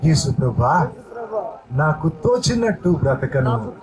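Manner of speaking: earnest pleading prayer, phrase by phrase; fervent prayer. Acted. Pastor leading the congregation's prayer aloud, fervent pleading prayer. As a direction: pray it aloud for all to follow.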